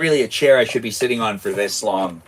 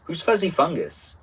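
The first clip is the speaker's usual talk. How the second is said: ordinary talk, nothing marked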